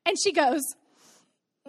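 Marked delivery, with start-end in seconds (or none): 1.7-1.7 put-on long drawn-out childish wail; hammed-up crying howl; mock sobbing wail. Mother imitates her six-year-old caught lying, mock wail. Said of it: "she starts sobbing".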